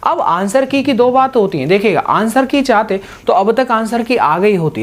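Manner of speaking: normal talking voice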